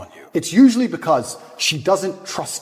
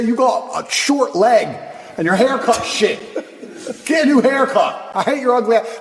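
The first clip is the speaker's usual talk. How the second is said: mock angry girlfriend; 7 semitones higher than usual. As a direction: hurl insults like an angry girlfriend. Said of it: "She'll sit there and say".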